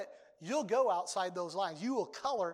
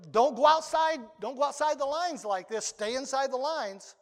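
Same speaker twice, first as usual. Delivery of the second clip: mock scolding; 3 semitones higher, 7 dB louder than usual. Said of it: "look at somebody and say".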